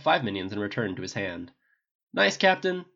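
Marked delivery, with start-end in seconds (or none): 2.1-2.8 higher, louder call across the gym. A narrator's reading. Tony praising the captain's shield throw across the gym, calling out. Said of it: "Tony called".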